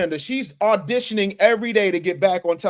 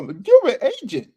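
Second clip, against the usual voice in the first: higher mocking accuser; pitched-up jeering quote; mock accusation; 8 semitones higher, 4 dB louder than usual. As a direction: imitate a critic's accusation, pitched up. Mimics critics accusing him.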